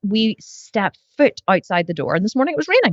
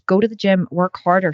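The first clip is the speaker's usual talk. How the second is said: ordinary delivery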